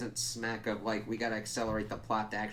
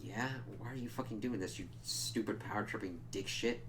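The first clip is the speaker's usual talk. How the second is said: muttered jab; 8 dB quieter than usual. Retold or imitated. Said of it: "it's like".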